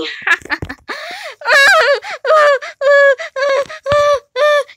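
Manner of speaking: high crazed cackle; maniacal laugh. Acted. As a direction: laugh like a madman.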